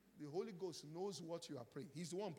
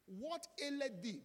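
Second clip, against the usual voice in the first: higher, louder, slow "aileth"; angel calling out; 9 semitones higher, 7 dB louder than usual. Acted. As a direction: call from heaven to Hagar. Preacher voices the angel calling.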